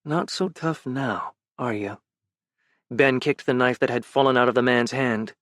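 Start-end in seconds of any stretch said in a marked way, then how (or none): 0.0-2.0 quiet menace. Acted. Ben pinning the mugger, quiet menacing taunt. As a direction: taunt him close to his ear.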